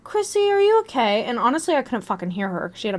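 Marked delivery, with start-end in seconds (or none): 0.0-1.2 high-pitched imitation of a concerned teacher; worried question in a raised pitch. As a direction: check on a sick student, voice lifted. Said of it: "I could just see the worry in her eyes".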